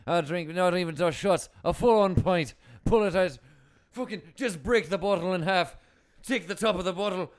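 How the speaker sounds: hammed-up Irish pub accent, loud; brash old-school Irishman boasting over whiskey. Podcast host plays a boozy Irishman arguing drinks, Irish accent. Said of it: "Can you do an Irish accent?".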